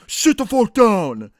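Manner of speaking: put-on dad roaring at the teen to sit down, slow furious yell; angry yell. Angry yell. Dad yelling in narrator's face.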